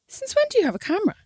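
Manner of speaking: a high, stunned since when; shocked question. Retold or imitated. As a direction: blurt it out in sudden surprise. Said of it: "And I went".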